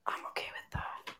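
whispered repeat, no voice; whispering. Shown as a demonstration. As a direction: breathe it without voice.

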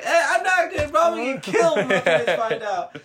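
high, loud, laughing objection; laughing protest. Retold or imitated. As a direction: insist half-laughing that it's bad. Protesting to his uncle.

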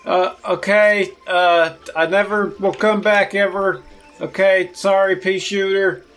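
hammed-up cowed promise; feigned surrender. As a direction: give in loudly for the onlookers. Chess fakes defeat for the crowd.

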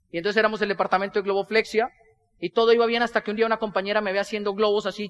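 normal talking voice

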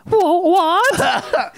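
high startled "what"; startled high squeak. Pretend. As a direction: squeak it in alarm. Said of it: "she's like".

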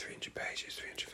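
very soft whispered aside; under-the-breath repeat; hushed echo. Pretend. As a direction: whisper it back as if marvelling. Hushed echo of the page count.

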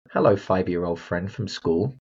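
usual speaking voice

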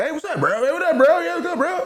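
high squeaky mocking bro voice; mock bro greeting. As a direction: squeal it like a giddy bro, laughing. Mocking guys greeting each other.

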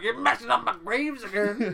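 high, loud put-on old man's grumble; grumpy gravedigger. Gravedigger scolds kids for messing his graves, put-on voice. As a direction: squawk it like a cranky cemetery keeper. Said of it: "he's like".

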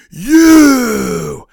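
loud, high, drawn-out wrestler's yell; Hulk Hogan. Acted. Cohost acts out Hogan turning on his opponent, wrestler impression. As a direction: bellow it at the opponent. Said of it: "and Hogan's like".

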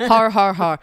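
loud mock-assistant "har, har, har"; Siri reading laughter. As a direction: read typed laughter aloud as separate words. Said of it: "I love when it reads out people's texts".

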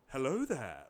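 low, soft mock greeting; Obi-Wan Kenobi. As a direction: drop low and quiet for a courtly hello. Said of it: "like, like Obi-Wan Kenobi".

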